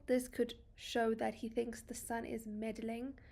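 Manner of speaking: ordinary delivery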